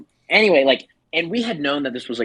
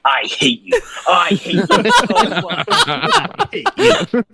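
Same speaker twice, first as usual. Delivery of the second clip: mock rage climbing high and slow; retold drunken anger; 14 semitones higher, 4 dB louder, much slower than usual. Tony turns on his rival, mock anger dissolving into laughter. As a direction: draw it out higher, breaking into laughter. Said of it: "like, really angry".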